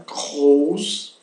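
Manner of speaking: "Groß" drawn out with a guttural R; throaty German R. Shown as a demonstration. Teacher reviewing pronunciation of the R, throaty German R. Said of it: "A pronúncia do R".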